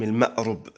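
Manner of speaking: المأرب said with "u" after the ra, ma'rub; damma on the ra. Shown as a demonstration. Teacher naming the variants of the fourth word, damma in المأرب. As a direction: sound المأرب as ma'-RUB. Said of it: "بالضم".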